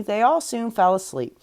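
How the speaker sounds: usual speaking voice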